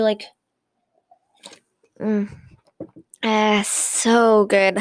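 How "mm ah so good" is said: drawn-out, very slow savouring; after-drink sigh. Shown as a demonstration. Podcaster showing her drinking habit, drawn-out satisfied sigh. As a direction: stretch it out as after a sip. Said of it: "So I just do".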